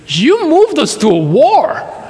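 a hint of shocked disbelief; stunned realization. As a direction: let it dawn on you in disbelief. Realizing he was taken into war.

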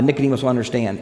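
normal talking voice

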